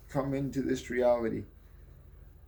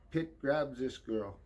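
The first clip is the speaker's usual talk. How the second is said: plain reading voice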